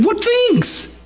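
high, startled "What things?" with "things" falling; surprised question. Pretend. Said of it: "he had to say this with a smile".

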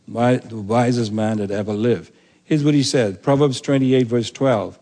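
normal talking voice

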